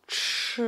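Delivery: "ch" with the tongue curled back, held. Shown as a demonstration. Teacher demonstrates C H I, "ch" drawn out with curled tongue. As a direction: model a long retroflex "ch". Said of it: "On tourne la langue".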